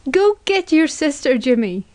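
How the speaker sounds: high-pitched insistent outburst; shrieking. A narrator's reading. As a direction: demand it in a mild shriek. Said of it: "shrieked the woman".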